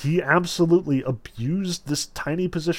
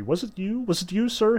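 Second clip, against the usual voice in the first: higher, hesitant faltering question; 7 semitones higher than usual. A narrator's reading. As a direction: falter over the words. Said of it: "he faltered".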